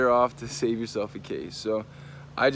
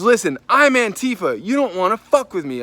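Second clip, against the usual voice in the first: loud, raised-pitch mock warning; imitated threat; 7 semitones higher, 7 dB louder than usual. Young man warning the storyteller off, loud imitated threat. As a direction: puff it up loud, mimicking his threat. Retold threat from aggressive male.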